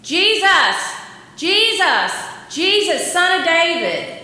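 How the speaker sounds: loud, high shouted call; acted desperate scream for attention; screamed plea. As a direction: shout it repeatedly over a crowd. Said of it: "ultimately he screamed".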